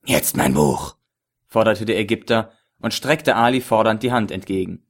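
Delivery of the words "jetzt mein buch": low, leering villain with a chuckle; lecherous villain voice. A narrator's reading. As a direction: play the sleazy dealer, slow and low. Ägypter fordert. (tiefe, lüsterne Schurkenstimme)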